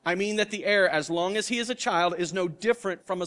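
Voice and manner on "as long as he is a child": normal talking voice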